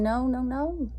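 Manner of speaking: usual speaking voice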